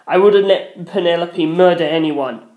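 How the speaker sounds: ordinary delivery, nothing marked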